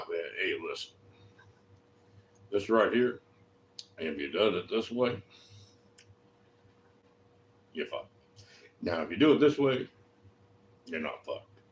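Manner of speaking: low and soft, with heavy pauses; quiet, direct warning. Shown as a demonstration. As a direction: drop right down and lay it out simply.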